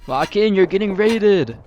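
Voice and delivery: high, loud taunting yell; loud shout. Acted. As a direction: shout a warning with glee. Taunting the raided players.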